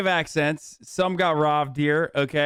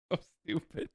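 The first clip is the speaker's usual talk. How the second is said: ordinary delivery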